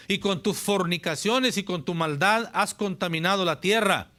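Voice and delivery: loud, high fiery scripture reading; fiery pulpit reading of Jeremiah; preaching at full force. Acted. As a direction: thunder the verses at the congregation. Preacher reads scripture aloud.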